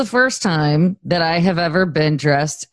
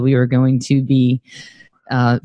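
ordinary delivery

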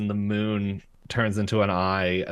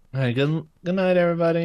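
sweet good night; 9 semitones higher, 4 dB louder, faster than usual. Retold or imitated. Mabel saying good night to puppets.

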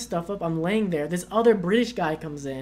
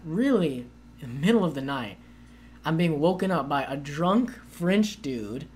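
normal talking voice

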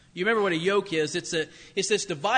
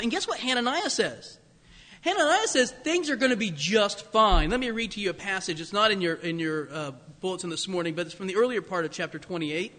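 ordinary talk

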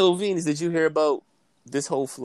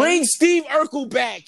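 shout; 10 semitones higher, 5 dB louder, slower than usual. Real, not acted. Shouting demand mid-banter.

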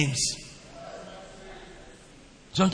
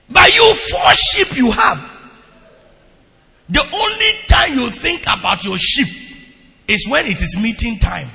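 loud, high scolding; preaching rebuke; 5 semitones higher, 7 dB louder than usual. Acted. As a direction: call the shepherds out for neglecting their sheep. Rebuking the congregation.

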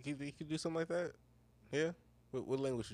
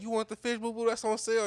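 higher-pitched acted go-between speaking for a friend; acted interpreter; 8 semitones higher, 3 dB louder than usual. Friend imagines someone talking for him at a counter, acted interpreter. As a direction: lightly voice a helpful translator, pitched up. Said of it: "he talk for you".

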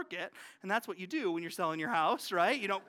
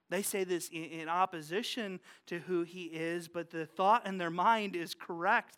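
usual speaking voice

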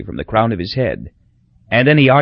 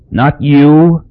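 louder, scornful sneer; 4 semitones higher, 8 dB louder than usual. A narrator's reading. Dying dictator to the priest, sneering loudly. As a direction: spit it out with contempt. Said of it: "he sneered at Doctor Humana".

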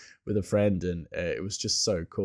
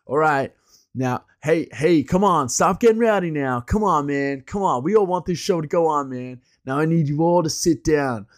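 higher, louder imitation of a house MC; West Coast hippie announcer; 5 semitones higher, 5 dB louder than usual. Announcer urging the crowd to sit down, imitated hippie pleading. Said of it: "trying to calm everybody down".

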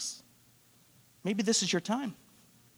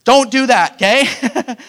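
louder, higher, teasing warning; playful loud warning; 6 semitones higher, 11 dB louder, slower than usual. Real, not acted. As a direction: scold them half-jokingly, loud. Pastor warns congregation playfully.

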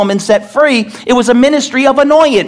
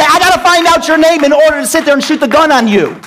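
a touch higher, sarcastic voice; mock reasoning; 5 semitones higher than usual. Preacher mocking needing a name before shooting an intruder, sarcastic. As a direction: make the idea sound ridiculous. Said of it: "I don't say".

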